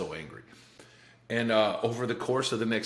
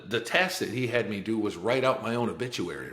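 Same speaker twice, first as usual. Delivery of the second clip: ordinary delivery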